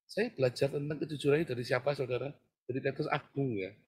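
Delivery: normal talking voice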